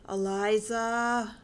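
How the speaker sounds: very slow, stretched out name; name dragged out. A narrator's reading. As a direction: drawl the name out slowly, pressing for an answer. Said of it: "William dragged her name out".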